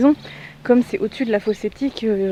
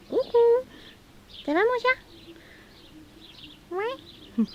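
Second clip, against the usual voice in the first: talking to a pet; 12 semitones higher, 6 dB quieter, slower than usual. Real, not acted. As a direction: coo at the cat in a high voice. Talking softly to her cat.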